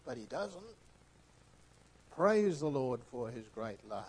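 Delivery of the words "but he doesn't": ordinary talk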